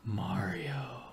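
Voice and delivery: eerie unvoiced whisper; creepy whisper. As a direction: breathe the name like a ghost.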